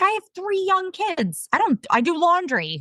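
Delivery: high-pitched embarrassed protest; mock helplessness, pitched well up; squeaky-high, self-deprecating quote; flustered self-mockery. Embarrassed at having nothing to write.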